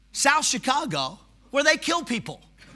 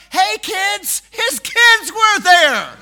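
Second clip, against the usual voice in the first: put-on loud, higher call to the kids; 8 semitones higher, 8 dB louder, much slower than usual. Bartender calling his kids over, loud and high. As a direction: bellow for the kids across the bar.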